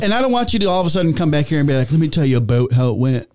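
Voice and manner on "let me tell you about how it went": bad Minnesota accent that comes out Irish; badly faked northern accent, Irish-sounding; botched Minnesota accent. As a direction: put on a low-pitched, clumsy Minnesota accent. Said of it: "Why are you doing an Irish accent?".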